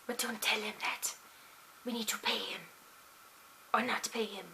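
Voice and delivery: soft conspiratorial whisper to the audience; hushed aside. Acted. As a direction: lean in and whisper the secret. Secret aside about paying the pear.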